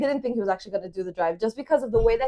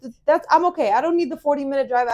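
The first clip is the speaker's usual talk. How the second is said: pitched-up, louder sassy retort; mock offended, louder and higher; mock indignant; 6 semitones higher, 5 dB louder, faster than usual. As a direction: act out the snippy brush-off. Retold snippy reply to date.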